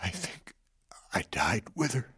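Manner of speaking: hushed, voiceless confession of loss; grieving whisper. Acted. Widower tells the healer of his dead daughter, whispered grief. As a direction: breathe it out, barely there.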